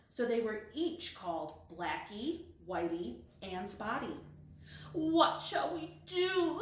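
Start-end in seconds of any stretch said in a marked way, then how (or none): 4.9-6.6 high, slow, worried little voice; a scared kitten hiding; frightened kitten. Acted. Blackie asking her sisters under the sofa, high and frightened.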